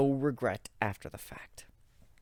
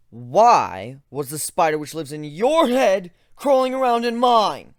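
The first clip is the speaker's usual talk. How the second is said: much higher and louder outraged yell; 7 semitones higher, 11 dB louder than usual. Acted. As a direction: shout the accusation. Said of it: "I yelled".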